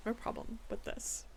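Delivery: whispered, quick grumble to herself; under-breath complaint. Real, not acted. Judge muttering her objection to the report, whispered. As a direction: mutter it fast, almost voiceless.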